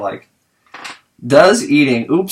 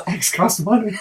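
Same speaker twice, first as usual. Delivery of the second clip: acted quote; 8 semitones higher than usual. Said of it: "he goes".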